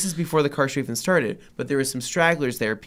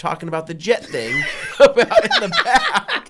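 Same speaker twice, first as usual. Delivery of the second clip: laughing through the words, louder; 6 semitones higher, 5 dB louder than usual. Real, not acted. Telling friends about the jet engine guy, laughing. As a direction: laugh as you tell it.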